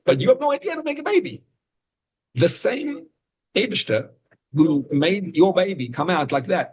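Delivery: excited teacher marveling at a newborn; amazed. Real, not acted. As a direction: let the awe lift the voice way up.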